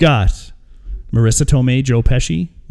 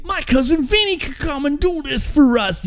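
mock bright idea; 13 semitones higher than usual. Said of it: "And they think brilliantly".

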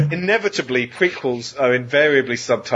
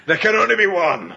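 movie quote shout; 6 semitones higher than usual. Retold or imitated.

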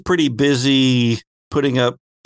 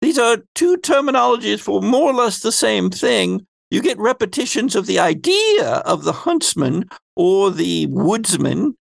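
higher, swooping lecturing voice; Phoebe; 3 semitones higher than usual. Acted. Phoebe explains Huntsman and Woodsman in her affected voice. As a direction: explain with big swings in pitch, in character. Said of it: "my Phoebe voice is this".

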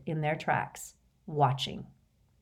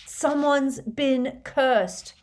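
slow, high bellow; 7 semitones higher, 4 dB louder, much slower than usual. A narrator's reading. Harry to Hagrid over raging wind, bellowing. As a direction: bellow each word to be heard. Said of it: "bellowed Harry".

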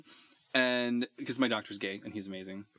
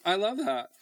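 much higher, thrilled; delighted; 13 semitones higher than usual. Retold or imitated. Patient thrilled at nurse's slang, much higher. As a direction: squeal with delight. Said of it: "I was like".

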